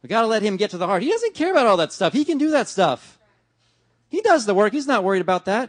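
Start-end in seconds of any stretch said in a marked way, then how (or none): 1.0-5.7 high, loud fervent preaching; preacher driving the point home; full-force preaching. Acted. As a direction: build to a passionate climax.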